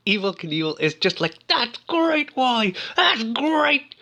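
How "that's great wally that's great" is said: high-pitched, over-acted encouragement; mock-thrilled, pitched way up; hammy cheering. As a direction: imitate a ham actor cheering someone on. Imitates Evel's hammy cheering of Wally.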